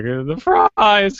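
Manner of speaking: squeaky pubescent voice, slow and high; awkward late-bloomer squeak, drawn out; cracking teen voice. Retold or imitated. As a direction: imitate a teenage voice breaking. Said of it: "kinda just cracking at every moment".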